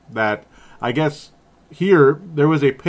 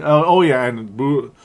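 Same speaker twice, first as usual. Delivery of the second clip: normal talking voice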